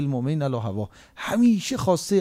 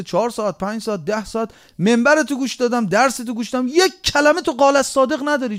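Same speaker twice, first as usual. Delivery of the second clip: fiery preaching; 4 semitones higher than usual. Acted. Challenges false scholars from pulpit.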